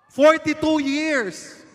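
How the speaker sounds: high, slow loud call across the room; host calling out to a couple in the crowd. Real, not acted. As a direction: shout the question to the back rows. Shouting the announced number.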